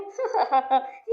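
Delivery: short guffaw at his misery; mocking laugh. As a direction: laugh at the weeping husband. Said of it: "खी खी खी खी खी हंस रहे हो".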